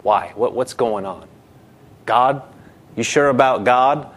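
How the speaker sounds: lightly voiced tempter, low and doubting; insinuating tempter. The enemy coming to make you doubt God, lightly insinuating. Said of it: "he'll come and say".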